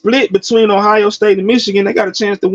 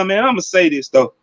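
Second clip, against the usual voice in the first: ordinary talk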